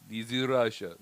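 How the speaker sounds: put-on Russian accent; bad Russian accent. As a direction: lightly put on Russian accent, low and soft. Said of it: "My accent for English people".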